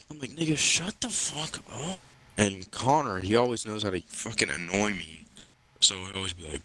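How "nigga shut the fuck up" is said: slow, higher-pitched mock exasperation; exasperated rebuff. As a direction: drag out an annoyed retort. Retold annoyed reply to friend.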